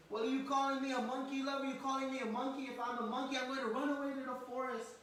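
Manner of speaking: put-on high, fast, loud childish threat; Krishna. Child answers mother's name-calling, threatening to run away. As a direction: fire back like an indignant child threatening to leave. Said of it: "threatening like this".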